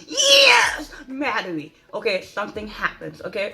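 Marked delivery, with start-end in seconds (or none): 0.1-1.7 sudden high shout on yes, then drops; retold scream. Cheering Maddie on in the cinema, shouting. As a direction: yell yes at the screen. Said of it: "I just screamed".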